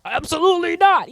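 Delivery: high-pitched, emphatic objection; mock firm refusal. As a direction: play an outraged objector. Acts out people who object.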